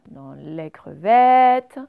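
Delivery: crevettes drawn out very slowly and loud on the è; very slow, loud crevettes for the class; sound demo. Shown as a demonstration. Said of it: "teacher showing the sound".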